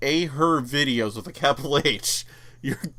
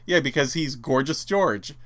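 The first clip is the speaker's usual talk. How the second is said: ordinary talk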